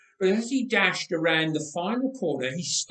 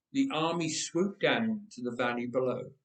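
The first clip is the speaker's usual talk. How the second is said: usual speaking voice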